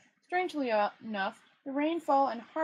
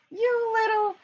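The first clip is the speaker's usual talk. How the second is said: high, loud furious outburst; exploding temper; 12 semitones higher, 8 dB louder, slower than usual. Acted. Rincewind's tortured temper exploding at the tourist. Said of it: "temper exploded".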